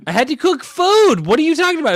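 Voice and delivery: high and loud, mock offended; indignant outburst. Real, not acted. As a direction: push back hard, almost yelling. Indignant about long day.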